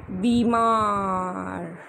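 बीमार very slowly, long ee after b, bee-maar; correct pronunciation. Shown as a demonstration. Teacher correcting a pupil, slow clear बीमार. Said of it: "बड़ी ई की क्या लगा देंगे".

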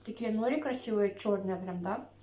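speaking around a bite; talking while chewing. Real, not acted. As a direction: mouth partly full of food. Seen 1: Bites roll at -2, jaw and cheeks working while talking.

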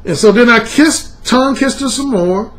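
ordinary talk

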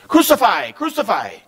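high, sharp repeated cries; crowd shouting. A narrator's reading. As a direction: shout it twice like an impatient mob. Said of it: "they shouted".

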